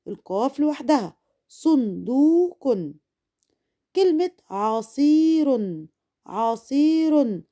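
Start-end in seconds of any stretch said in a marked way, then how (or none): 1.5-2.8 "صندوق" slowly split as sun-duu-qun; syllable by syllable. Shown as a demonstration. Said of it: "أما حللها المقاطع الصوتية". 4.5-7.4 "عصير" slowly as a-sii-run; syllable by syllable. Shown as a demonstration. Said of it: "أما حللها المقاطع الصوتية".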